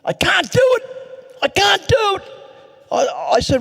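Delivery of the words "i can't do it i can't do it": a desperate high shriek of Wally; retold scream. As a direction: scream it out in a panic. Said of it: "he screamed at me".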